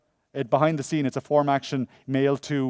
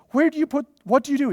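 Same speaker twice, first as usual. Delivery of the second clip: incredulous question; 12 semitones higher, 4 dB louder than usual. Retold or imitated. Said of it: "I says".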